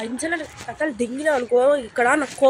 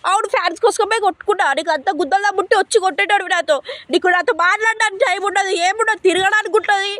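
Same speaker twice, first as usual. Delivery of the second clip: raised, much higher voice in a heated fight; angry shouting; 6 semitones higher than usual. Acted. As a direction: yell it out, fed up and furious. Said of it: "మెల్లగా మాట్లాడు".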